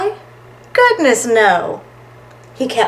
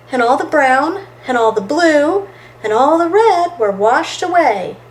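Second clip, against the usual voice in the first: plain reading voice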